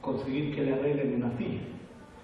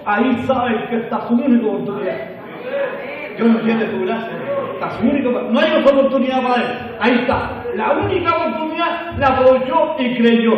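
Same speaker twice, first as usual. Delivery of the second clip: loud, impassioned sermon delivery; full-force preaching; 7 semitones higher, 11 dB louder than usual. Real, not acted. Preacher urging that it was his only chance, preaching forcefully. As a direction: drive it home to the congregation at high volume.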